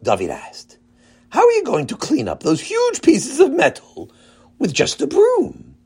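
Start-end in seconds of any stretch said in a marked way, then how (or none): 1.3-5.8 put-on high young boy voice; child voice. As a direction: voice a curious kid, higher. Boy David asks.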